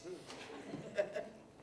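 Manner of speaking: unvoiced laugh of delight; storyteller laughs, delighted by the cheer; breathy laugh. Real, not acted. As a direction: laugh out at the memory.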